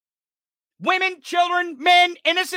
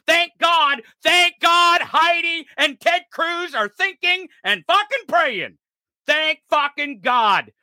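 exaggerated praise, pitched up in scorn; sarcastic gratitude; 3 semitones higher than usual. Real, not acted. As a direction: exclaim thanks with biting sarcasm. Mocking thoughts and prayers.

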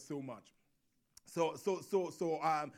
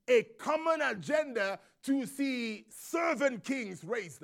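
preaching; 5 semitones higher, 8 dB louder than usual. Acted. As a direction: drive the congregation on with rising force. Preaches at full force.

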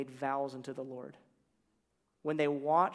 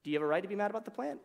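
fast, higher quick question; rushed recap; 5 semitones higher, much faster than usual. Retold or imitated. Retelling God's question in a fast higher voice. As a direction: zip through the line. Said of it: "Lord's like".

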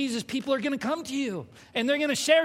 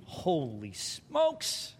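stunned aside; 6 semitones lower, 5 dB quieter, much slower than usual. Real, not acted. Recalling own shock.

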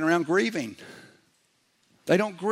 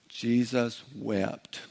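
very slow, softer delivery; drawn-out recital; 5 semitones lower, 7 dB quieter, much slower than usual. Shown as a demonstration. Preacher helping the congregation memorize a short verse, slow recital. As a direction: say it word by word, slowly and quietly.

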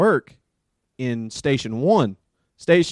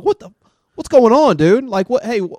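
raised, pleading calm-down voice; hey-dude calming plea; calming a patient; 6 semitones higher, 6 dB louder than usual. Retold or imitated. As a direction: try to settle a screaming patient. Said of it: "I'm like".

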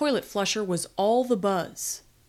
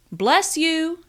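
higher, louder called-out blessing; calling out; 8 semitones higher, 5 dB louder than usual. A narrator's reading. Stacy calls to whoever is coughing, calling out. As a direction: call it out to someone unseen. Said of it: "Stacy called out".

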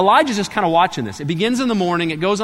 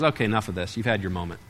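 Elijah, quietly done; 7 semitones lower, 5 dB quieter, faster than usual. Retold or imitated. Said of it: "And Elijah goes".

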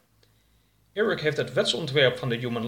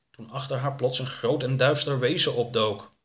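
plain reading voice

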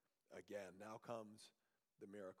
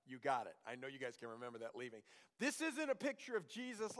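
ordinary talk, nothing marked

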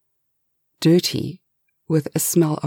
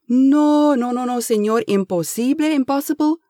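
put-on pleading Spanish accent; 8 semitones higher than usual. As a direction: beg the boss in a Spanish accent. Eustasio disbelieving.

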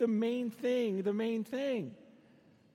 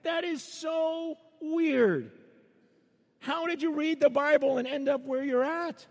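higher, puzzled voice of a foreigner, so weird slowed right down; mock amazement at odd customs; baffled outsiders; 6 semitones higher, 4 dB louder than usual. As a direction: outsider asking Americans how they got there, incredulous. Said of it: "they look at what we do and go".

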